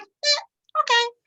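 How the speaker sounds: high, airy brush-off of a rejection; a mock carefree eh okay next; breezy dismissal. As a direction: shrug it off lightly and move on. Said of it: "You're like".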